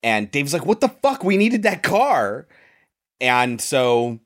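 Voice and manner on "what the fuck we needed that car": exasperated outburst. Retold or imitated. Dave angry about wrecked car.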